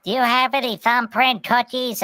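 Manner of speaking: Pudgy voice. Retold or imitated. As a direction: ask sweetly like a little critter. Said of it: "Pudgy's like".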